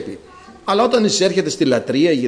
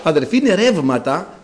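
ordinary delivery

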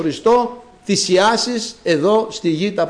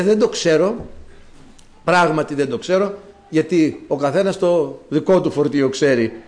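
ordinary talk

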